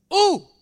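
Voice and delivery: high, loud ooh of delight; excited ooh. Real, not acted. Preacher whoops loudly at Jesus' words, excited. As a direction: whoop at the punchline.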